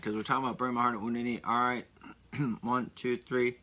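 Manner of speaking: ordinary talk, nothing marked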